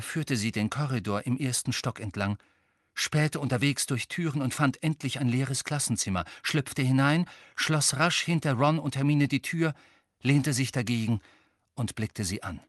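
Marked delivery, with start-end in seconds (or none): none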